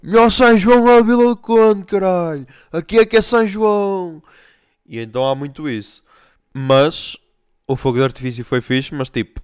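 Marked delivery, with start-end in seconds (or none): 0.0-4.2 loud, high boastful local voice; locals bragging. Retold or imitated. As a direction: brag like a proud local. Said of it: "o pessoal é".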